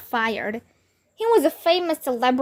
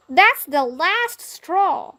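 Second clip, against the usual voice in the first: slow, higher fed-up boss; higher, slow mock anger; angry shout; 6 semitones higher, much slower than usual. A narrator's reading. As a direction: spell it out in fury. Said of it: "Missus Crump shouted at him".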